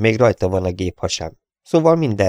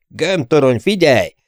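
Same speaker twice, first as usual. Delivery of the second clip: raised urgent call over the intercom; 6 semitones higher, 4 dB louder than usual. A narrator's reading. Gunner calling the ball turret, raised urgent warning.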